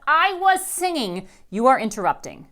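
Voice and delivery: stamp each word in a fury; boiling mad. A narrator's reading. Rascal yells at mom in a tantrum, loud angry. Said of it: "I am boiling mad".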